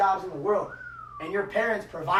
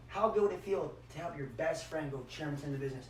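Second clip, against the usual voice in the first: usual speaking voice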